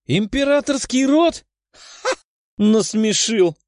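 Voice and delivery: high-pitched, derisive outburst; incredulous, mocking exclamation; scornful exclamation. A narrator's reading. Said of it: "воскликнула Даша".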